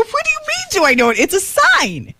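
high, baffled question. Retold or imitated. Wife confused by daughter's question, baffled. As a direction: squeak out the confusion. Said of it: "I go".

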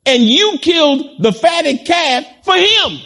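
preacher shouting the elder brother's grievance; accusing shout. Acted. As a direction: hurl it at the father.

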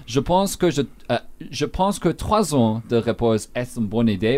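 the full French sentence read with clean pronunciation; French demo. Shown as a demonstration. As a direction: model the French sentence for the listeners. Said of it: "I'll give you a sense".

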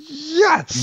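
fanboy triumph; high-pitched delighted yes; excited yes. Retold or imitated. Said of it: "I'm like".

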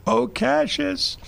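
slow, high, stretched Oh, Cassius; recalled call. Retold or imitated. Teller fondly re-calling his mother's call, slow and high. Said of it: "I'll never forget that".

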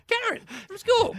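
very high, perky self-introduction; sunny, friendly. Acted. Teller reminding an old schoolmate who she is, sunny. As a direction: chirp it in a put-on sunny voice. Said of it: "she was a really sunny, friendly person".

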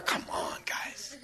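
hushed unvoiced aside to the crowd; whisper. Real, not acted. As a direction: lean in and whisper it like a secret. Aside to the congregation.

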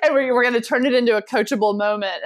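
ordinary delivery

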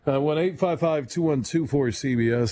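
ordinary talk, nothing marked

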